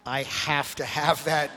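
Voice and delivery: drawn-out, wistful mock plea; slow, drawn-out longing. Pastor retelling how he eyed Kev's hat, slow and wistful. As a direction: stretch out every word of "I have to have that" slowly. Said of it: "and I'm like".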